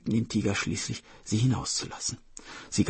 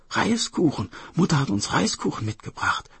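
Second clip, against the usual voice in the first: put-on higher delighted child's cry; excited sister calling; 6 semitones higher than usual. Sister hears of rice cakes, excited calling. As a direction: exclaim eagerly as the sister. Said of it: "rief die Schwester".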